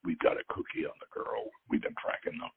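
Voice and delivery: hushed, nearly whispered aside; low voice. A narrator's reading. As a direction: keep it secret, softly under the breath. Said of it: "said in a low voice".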